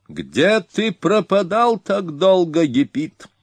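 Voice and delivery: very high, slow mocking call; an arrogant young Hun sneering from the saddle; insolent barbarian. A narrator's reading. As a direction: taunt the king from high up, drawing out пропадал. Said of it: "продолжал дерзкий варвар".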